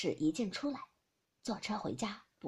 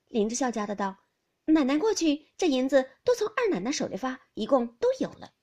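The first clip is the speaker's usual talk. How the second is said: louder, higher servant woman; servant's answer; 3 semitones higher, 7 dB louder than usual. Acted. Lin's wife answering her mistress, louder and higher. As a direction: list it off obediently.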